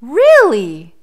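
surprised bear. A narrator's reading. Bear hears of beasts in the forest, surprised. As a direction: react with big mock surprise. Bear reacts to boy's claim.

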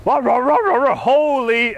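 high, loud, slow panicked rah; panicked mock barking. Dog panicking on the stairs, high loud barking. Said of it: "dog panicking in story".

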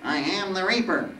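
high, whiny insistence; whining Reaper. Acted. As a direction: insist on your title in a nasal whine. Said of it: "the voice whined".

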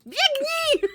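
high, loud panicked shout; loud alarm cry; frantic yell. Acted. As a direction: scream at them to run. Urging escape after being caught.

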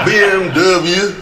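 loud, drawn-out B M W in imitated Texan; Texan mispronunciation. Presenter mimicking the car dealer, slow mispronounced B M W. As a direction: stretch B M W slowly like a Texan dealer. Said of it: "he mispronounced every single one".

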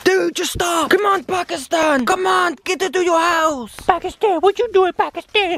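high, pleading yell at the dog; exasperated yelling. Real, not acted. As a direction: call the dog in, fed up. Dog won't go in house.

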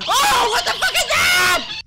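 very high, loud shriek; terrified scream. Real, not acted. Player seeing something horrible in the game, terrified scream. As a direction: scream it out in shock. Clown jump scare.

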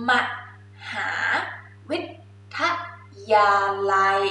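มหาวิทยาลัย split into ม, หา, วิ, ท, ยา, ลัย with a pause between each; teacher breaking a long word into parts; syllable by syllable. Shown as a demonstration. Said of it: "มหาวิทยาลัย Oxford".

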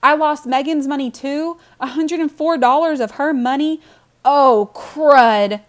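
high, loud, incredulous dismay; loud, horrified realization; dismayed outburst. A narrator's reading. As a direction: wail it as the loss sinks in. Boy realizes he lost sister's friend's money.

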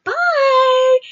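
high, loud, dismissive sing-song bye; hammed-up chirpy farewell; mock cheery goodbye. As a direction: wave her off breezily. Lady dismisses her cheerily.

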